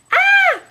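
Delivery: very high, loud scream; piercing scream. Acted. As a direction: shriek as you're eaten. Grandmother being eaten.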